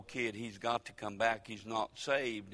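normal talking voice

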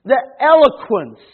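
slow, emphatic pulpit delivery with big pauses; measured, building sermon proclamation; forceful preaching. Real, not acted. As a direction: preach it out, leaning hard on key words. Preaches on the word so.

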